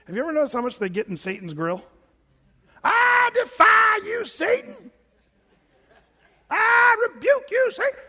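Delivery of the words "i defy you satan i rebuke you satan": high, drawn-out quivering shout, hammed-up; mock a charismatic bellowing at the devil; over-the-top devil rebuker. Preacher mimicking ultra Pentecostals getting in Satan's grill, loud high wail.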